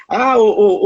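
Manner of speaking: normal talking voice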